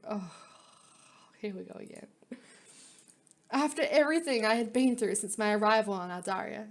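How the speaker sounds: ordinary talk, nothing marked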